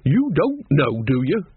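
ordinary delivery

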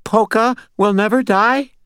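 high-pitched, unsure question; scared, questioning echo; timid repetition. A narrator's reading. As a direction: repeat it nervously in a high voice. Scared Butters repeats it unsurely.

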